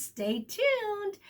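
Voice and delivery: ordinary delivery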